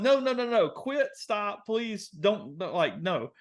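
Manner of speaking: high pleading protest, easing off; freaking out. Retold or imitated. Passenger pleading with the driver, freaking out. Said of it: "I'm, like, freaking out".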